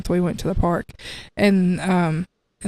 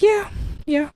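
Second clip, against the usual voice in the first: high, giddy imitated yeah yeah; excited; 12 semitones higher than usual. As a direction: bounce it up high like an excited kid. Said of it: "he just got so excited".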